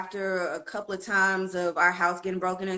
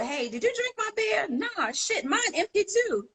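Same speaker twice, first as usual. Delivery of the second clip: voiced aunts; 13 semitones higher than usual. Retold aunts quarrel over beer.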